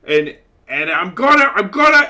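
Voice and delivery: put-on drunken rage. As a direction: rage until the words fail you. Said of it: "And says".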